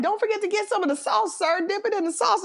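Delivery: high, fast eager coworker voice; pushy coworker. Retold or imitated. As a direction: rush it high, pushing the sauce. Coworker pushing sauce on boss.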